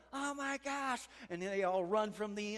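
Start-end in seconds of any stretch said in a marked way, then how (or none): 0.1-1.0 much higher, stunned; the pitcher seeing the plane, higher and awed; amazed gasp. Retold or imitated. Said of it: "and they're like".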